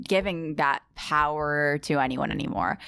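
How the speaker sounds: ordinary talk, nothing marked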